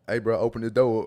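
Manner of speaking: loud shout to get let in; calling out. Acted. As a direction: yell it at someone behind a door. Acts out calling at a door.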